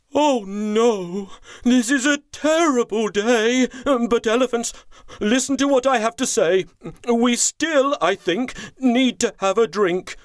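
rally the herd in a high voice; chief elephant. Acted.

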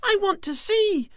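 very high excited squeal. Acted. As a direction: squeal with eager delight. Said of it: "squealed Squiggy".

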